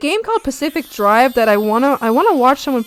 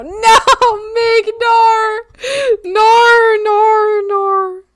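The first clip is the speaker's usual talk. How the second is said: high wailing frog; 11 semitones higher, 6 dB louder, much slower than usual. Pretend. Voicing sad frogs protesting.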